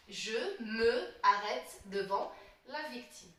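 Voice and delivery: slow, "me" and "arrête" kept as two separate words; unelided je me arrête. Shown as a demonstration. Teacher reading out the faulty sentence, slow, "me" unelided before "arrête". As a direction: say "me arrête" in full, without elision. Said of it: "au lieu de dire «je me arrête»".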